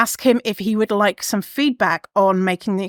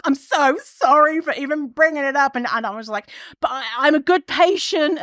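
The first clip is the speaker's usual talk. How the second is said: higher pleading grovel; groveling apology; 6 semitones higher than usual. Retold or imitated. Groveling to the doctor to be seen as good, high pleading. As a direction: beg like a sorry child. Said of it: "being like".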